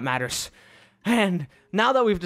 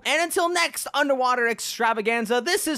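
higher, upbeat channel sign-off; outro sign-off; 8 semitones higher than usual. Acted. Host signing off to viewers, in an upbeat, higher voice. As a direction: sell the subscribe like a host.

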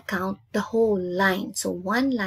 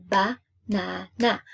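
BA, NA, NA with a gap after each; three separate beats. Shown as a demonstration. Teacher showing three parts of a word, beat by beat. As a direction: break "ba, na, na" into three even beats. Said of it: "three beats or parts".

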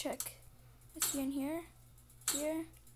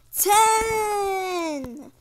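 put-on loud, higher kid answer; eager student; 6 semitones higher, 11 dB louder than usual. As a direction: blurt the answer like a keen pupil.

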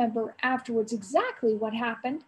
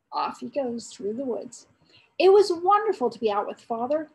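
usual speaking voice

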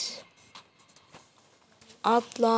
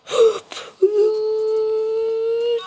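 long gasp, much higher; 10 semitones higher than usual. Real, not acted. Gamer gasping as his character leaps, long high gasp. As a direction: gasp in alarm. Big jump in game.

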